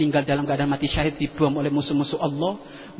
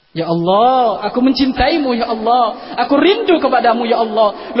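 louder, passionate cry to God; fervent prayer; 5 semitones higher, 6 dB louder, slower than usual. Acted. Preacher crying out to God in prayer, fervent. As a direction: pray out loud with longing.

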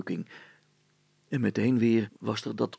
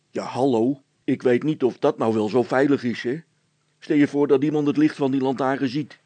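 hesitant officer; 4 semitones higher, 8 dB louder than usual. A narrator's reading. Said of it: "aarzelde de veldwachter".

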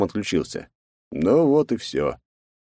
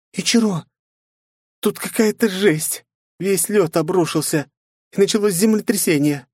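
put-on much higher, alarmed young woman; Night reporting; 13 semitones higher than usual. A narrator's reading. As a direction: report the chaos. Night on radio.

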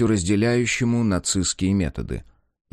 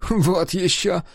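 much higher scornful scoff; a hint of scornful dismissal; dismissive; 9 semitones higher, slower than usual. A narrator's reading. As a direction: brush it off with scorn. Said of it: "пренебрежительно отозвался Генрих".

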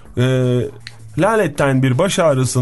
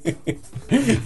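high, soft burst of giggles; amused laugh; 8 semitones higher, 6 dB quieter than usual. Real, not acted. Laughing at own wrong word, amused. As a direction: crack up at your own slip.

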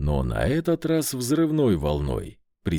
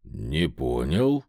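drawled; 9 semitones lower, slower than usual. Acted. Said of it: "протянул я".

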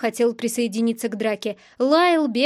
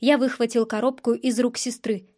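usual speaking voice

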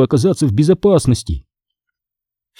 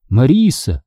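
ordinary delivery, nothing marked

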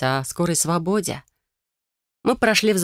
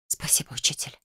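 hushed, whispered thanks to a mentor; grateful whisper; 9 dB quieter than usual. A narrator's reading. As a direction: whisper it with gratitude. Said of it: "прошептала я". (благодарный шёпот)

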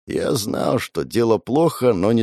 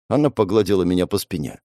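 ordinary delivery, nothing marked

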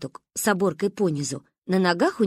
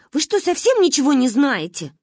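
high louder exasperated scolding; scornful; 6 semitones higher, 5 dB louder than usual. Acted. Varya scolds the ignorant kids. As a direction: scold them for knowing nothing.

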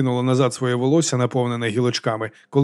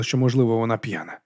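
usual speaking voice

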